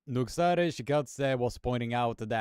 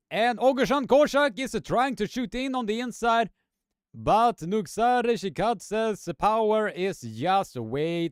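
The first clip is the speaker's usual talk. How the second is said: excited ringside commentary, pitched up and pushed; live play-by-play; 7 semitones higher than usual. Acted. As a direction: call the action like a live sportscaster. Live arm wrestling play-by-play.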